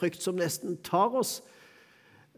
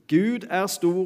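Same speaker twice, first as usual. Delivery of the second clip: Gud er stor with the weight on Gud, raised; emphatic contrast; 4 semitones higher, 4 dB louder, slower than usual. Acted. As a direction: lift and stress Gud in Gud er stor. Preacher contrasts God and man. (trykk på Gud i Gud er stor)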